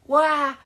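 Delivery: high, loud wailing cry. Acted. William crying in class, high wail. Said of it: "wailed Weepy William".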